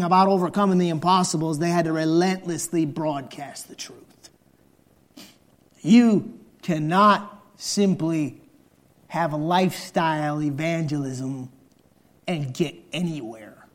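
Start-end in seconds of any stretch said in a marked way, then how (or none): none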